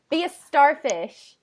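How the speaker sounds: high, loud, drawn out through laughter; laughing. Real, not acted. Channeler tickled by the spirit's shape-shifting offer, laughing. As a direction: burst out laughing as you say it.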